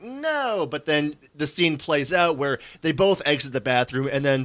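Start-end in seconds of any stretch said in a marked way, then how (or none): 0.0-0.7 higher-pitched quoted no; reluctant woman's refusal. Retold or imitated. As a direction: lightly voice her saying no. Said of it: "she was like".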